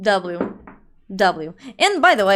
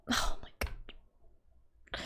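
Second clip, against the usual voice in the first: slow, barely audible whisper; aghast whisper; 17 dB quieter, much slower than usual. Acted. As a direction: whisper it in stunned dismay. Aghast after motorcycle news.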